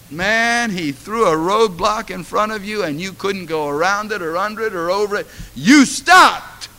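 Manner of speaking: loud, high climactic preaching; preaching at full force. Acted. Pastor exhorting the church, loud fervent preaching. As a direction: drive it home to the congregation.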